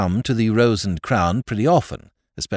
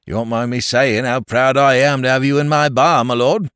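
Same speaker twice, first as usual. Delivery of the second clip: chatty London publican, a touch louder; homely, cheerful Cockney barman; Cockney landlord; 4 semitones higher, 6 dB louder than usual. A narrator's reading. Landlord reminiscing to the lord, cheerful Cockney chat. Landlord proud of his guest.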